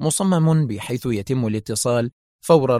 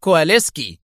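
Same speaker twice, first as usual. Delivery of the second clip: raised-pitch stern phone answer; agitated sternness; 4 semitones higher, 4 dB louder than usual. A narrator's reading. Kwaleski picking up his private phone, stern. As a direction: announce your name tensely and severely. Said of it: "قائلا في صرامة منفعلة".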